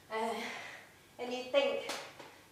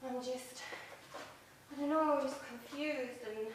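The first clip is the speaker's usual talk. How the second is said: ordinary talk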